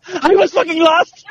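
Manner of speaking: high, mocking frustrated whine; imitating Albert. Friend teasingly imitating the lost hiker, mock frustrated. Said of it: "but I could just hear you".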